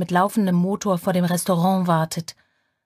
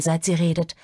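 plain reading voice

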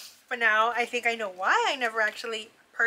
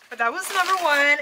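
ordinary delivery

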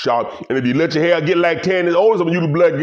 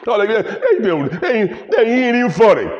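high, laughing through the words; cracking up mid-rant; giggling scorn; 8 semitones higher than usual. Real, not acted. Laughing rant about Chappelle.

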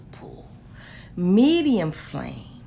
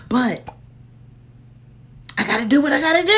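louder resolve; 7 semitones higher, 10 dB louder, much faster than usual. Real, not acted. Bracing to cut the candle.

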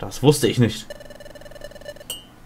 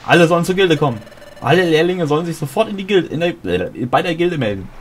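higher and louder urgent call; urgent, out of breath; 7 semitones higher, 3 dB louder than usual. Acted. Messenger rushing in with news, urgent and breathless. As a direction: burst in with hurried news. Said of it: "Du bist ganz außer Atem".

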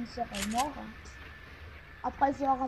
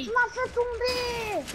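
plaintive and drawn out, pitched up; pleurnicher; 9 semitones higher, slower than usual. Real, not acted. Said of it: "Arrête de chialer". (voix plaintive qui traîne)

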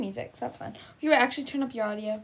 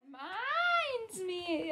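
slow, higher, softer mock-tearful mother; mock grieving; 5 semitones higher, 8 dB quieter, slower than usual. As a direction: draw it out as a hammed-up sad mother. Voicing grieving mother in game.

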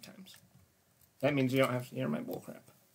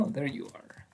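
ordinary talk

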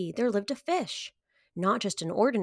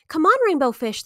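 loud, cheerful call across the water; 6 semitones higher, 7 dB louder than usual. A narrator's reading. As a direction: call him over to play. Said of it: "they called".

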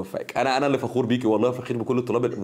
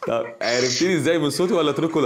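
amused question; 3 semitones higher than usual. Real, not acted. Still laughing, asks student.

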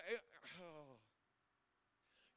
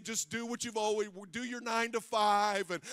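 sing-song mock lulling; 11 dB louder than usual. Voicing the lulling stuff.

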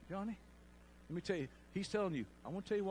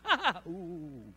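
retold laughing; 4 dB louder, slower than usual. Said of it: "busting out laughing".

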